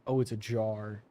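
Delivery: ordinary delivery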